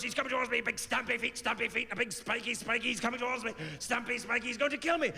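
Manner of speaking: high, breathless dread; hammed-up fear as the rhino charges; mock panic.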